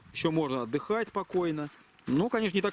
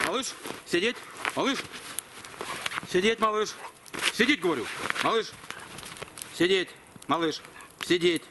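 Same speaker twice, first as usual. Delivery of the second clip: firm dog command; 4 semitones higher than usual. Real, not acted.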